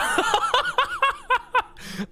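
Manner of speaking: a very high squealing laugh. Real, not acted. Caster squealing with laughter at the fake out. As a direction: break into helpless high laughter. Caster cracks up mid sentence.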